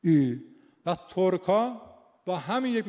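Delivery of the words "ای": loud held «ای.» as the vowel between the two; demonstrated in-between vowel. Shown as a demonstration. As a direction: sound out «ای.» as the middle vowel. («ای.» بلند، میان دو واکه)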